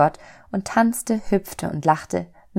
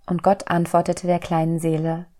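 ordinary delivery, nothing marked

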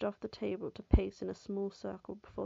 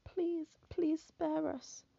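high, tearful and pleading; a terrified sister begging through tears; weeping plea; 9 semitones higher, 4 dB louder, much slower than usual. A narrator's reading. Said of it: "Elaine wept".

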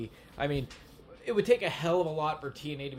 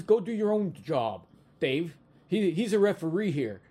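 put-on southern accent; 6 semitones higher, 6 dB louder than usual. Jimmy Hart telling Dave off, in a southern accent. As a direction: mimic a southern wrestling manager telling Dave off. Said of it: "he's got that southern accent".

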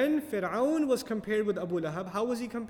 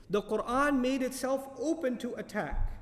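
normal talking voice

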